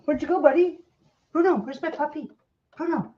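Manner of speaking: calling for Bruno the puppy, sing-song; pet talk. Real, not acted. Calling for the dog.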